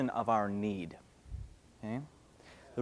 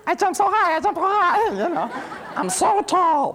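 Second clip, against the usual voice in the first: put-on high, louder bragging kid voice; boastful child; 6 dB louder than usual. Child boasting about jumping high, high and loud. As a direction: brag like a proud little kid. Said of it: "and we're like".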